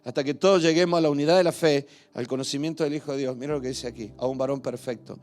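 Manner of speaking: loud, driving sermon delivery; fervent preaching. Acted. Pastor preaching Ephesians to the congregation, loud fervent. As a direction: preach it out full force to the hall.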